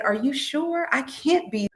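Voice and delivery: retold disbelief. Patient doubting the nurse about her weight, mild disbelief. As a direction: question it, mildly incredulous.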